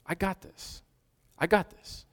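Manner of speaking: calm them like a friend; gentle reassurance. Acted. Preacher voicing Jesus, softly reassuring.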